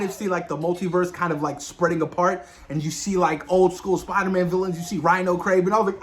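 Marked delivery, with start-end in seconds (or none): none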